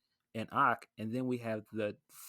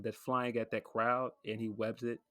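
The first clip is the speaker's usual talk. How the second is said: normal talking voice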